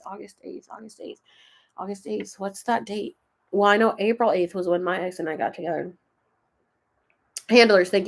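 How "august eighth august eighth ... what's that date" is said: muttering to herself. Real, not acted. Murmurs date to herself.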